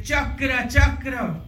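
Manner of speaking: high, ringing call for the weapon; retold angry call; angry summons. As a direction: cry out commanding the Chakra in fury. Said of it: "he was so angry".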